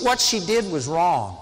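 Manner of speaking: ordinary delivery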